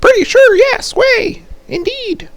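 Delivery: very high squeaky mock voice. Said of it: "And I was like".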